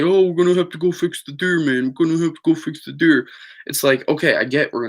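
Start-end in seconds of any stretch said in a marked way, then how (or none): 0.0-3.3 higher, hurried mock-dad voice; rushed, put-on father impression. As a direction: imitate dad fussing, fast and pitched up. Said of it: "and my father was like".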